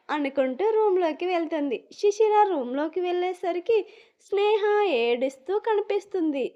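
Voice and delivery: ordinary delivery, nothing marked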